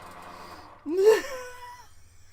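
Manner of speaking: high, wheezing laugh near tears; helpless laugh. Real, not acted. Cracking up at her own flower joke, a high wheezing laugh. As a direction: laugh until it squeaks.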